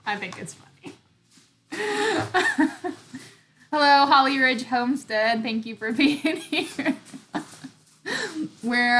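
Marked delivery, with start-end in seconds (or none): 1.7-3.2 bright high laugh; high giggle. Real, not acted. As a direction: burst into giggles. Laughing at husband's awkwardness.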